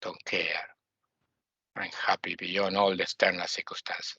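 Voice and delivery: low, whispered don't-care calm; mock serene whisper. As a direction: whisper it as if nothing can touch you. Voices a detached, unshaken person.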